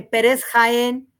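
Jaén with a sounded J and the stress on the é; slow, careful correction. Shown as a demonstration. Deputy correcting how her name is said, slowly. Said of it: "la J suena y lleva acento".

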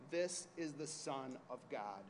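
ordinary talk

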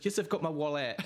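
words breaking into laughter; laughing. Real, not acted. Friend quoting the show while cracking up, laughing. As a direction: laugh the line out.

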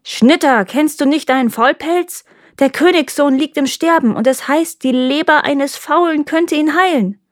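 high-pitched proclamation from horseback; calling out. A narrator's reading. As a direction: hail the reaper with urgent news. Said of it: "rief sie".

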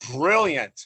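hammed-up congratulation; mock delight. Father Ron to a new patient, hammed-up praise.

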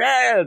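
high playful crowd shout; a riled-up cheer from the mob, half-joking; mock crowd yell. Cult kids roaring along with Exodus, high mock shout. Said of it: "They're all yelling".

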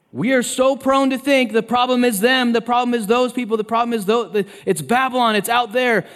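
high, loud, piling-up preacher delivery; impassioned insistence. Acted. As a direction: drive each repeat home urgently. Preacher mimicking congregation's insistent blame.

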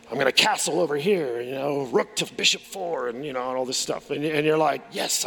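a hint of a smug planner laying out his moves; smug master planner.

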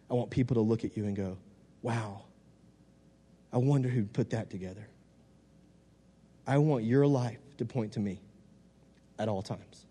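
hushed message from God; gentle divine voice. Retold or imitated. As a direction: relay God's words quietly and tenderly. God speaking gently, retold.

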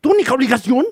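high, loud scolding parent; strict parent. Acted. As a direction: lecture the kid like a strict mother. Acting a strict Chinese parent.

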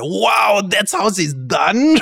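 shouted high wow, then slow awe; amazed wow. Retold or imitated. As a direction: relive the moment of discovery. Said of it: "I was like".

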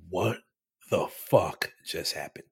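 low, hushed disbelief; quiet stunned wonder, barely voiced. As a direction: breathe it out, dazed after the shaking. Stunned after the earthquake stops.

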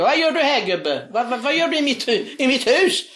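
high startled shout at the intruder; retold scream. Woman screaming at a stranger in her bedroom, startled shout. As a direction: cry out in alarm at the old man. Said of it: "Hon skriker".

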